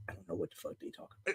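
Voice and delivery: fast whispered aside. Retold or imitated. Father whispering fast to his friend Bill about the kids' game. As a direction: hiss it so kids can't hear.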